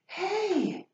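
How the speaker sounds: high shouted call; bully's high yell. Acted. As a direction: call out sharply again. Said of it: "He heard it again".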